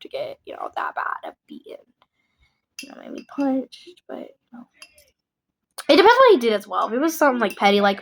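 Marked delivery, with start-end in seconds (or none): 0.0-4.7 whispered. Real, not acted. Drops to whisper mid-sentence.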